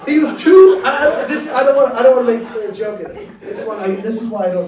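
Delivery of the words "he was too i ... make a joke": laughing. Real, not acted. Catches himself mid joke.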